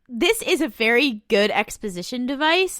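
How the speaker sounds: normal talking voice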